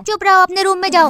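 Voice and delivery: loud, sharp parental order; angry scolding. Acted. Mother sends arguing daughter to her room, angry scolding.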